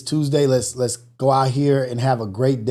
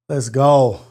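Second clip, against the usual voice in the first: ordinary delivery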